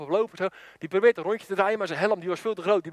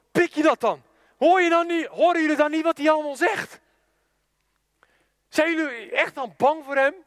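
outraged challenge; 6 semitones higher than usual. Retold or imitated. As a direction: challenge the soldiers in disbelief. Little brother outraged at passive soldiers. (verontwaardigd, hoog en luid uitdagend)

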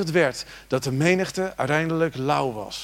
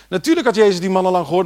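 louder, higher and fast; emphatic repeat; 4 semitones higher, 6 dB louder, faster than usual. Shown as a demonstration. Preacher repeats it to the church, louder and fast. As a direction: hammer it home the second time. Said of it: "En natuurlijk had Jezus die man al lang gehoord".

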